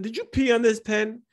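high, startled question; asking in disbelief; surprised. Retold or imitated. Shocked question to wife.

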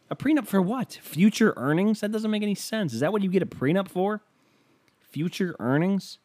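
high-pitched questioning, rising in disbelief; mock incredulous. Arguing with partner over what a prenup covers, mock incredulous.

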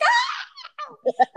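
high little scream, laughing through it; mock baby scream with a laugh; imitated toddler scream. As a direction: shriek like a scared baby. Toddler terrified scream retold.

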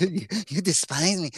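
lightly voiced cartoon chihuahua; mock Ren voice. Podcast host slipping into a cartoon voice, lightly voiced. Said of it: "so easily into Ren and Stimpy voice".

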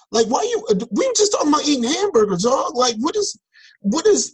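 high-pitched, incredulous retold challenge; exasperated protest. Man challenging coworker who spoiled burger talk, exasperated protest. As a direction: plead your case, baffled and fed up.

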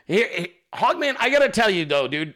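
usual speaking voice

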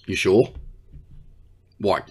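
ordinary talk

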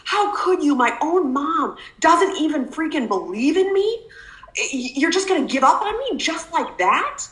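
put-on teen outburst. Retold teen outburst at mom.

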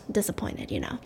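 a breathy unvoiced aside; whispered letdown. Real, not acted. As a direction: drop to a whisper for the joke.

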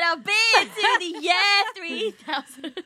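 YEAR drawn out like "yeah", slow and high; mock mispronounced year. Imitating a friend who can't say year, mock mispronounced year. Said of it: "She said".

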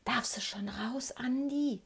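secretive whisper into the phone; hushed, conspiratorial question. A narrator's reading. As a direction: whisper it slowly, so nobody overhears. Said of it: "flüsterte ich in den Hörer".

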